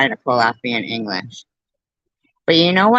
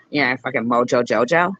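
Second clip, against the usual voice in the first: ordinary delivery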